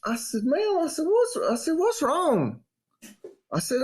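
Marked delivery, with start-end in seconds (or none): 0.4-0.8 high, stunned ma'am; retold disbelief, pitched high; incredulous. Confronting neighbor, retold. 1.0-1.4 high, stunned ma'am; retold disbelief, pitched high; incredulous. Confronting neighbor, retold. 1.7-2.6 high, stunned ma'am; retold disbelief, pitched high; incredulous. Confronting neighbor, retold.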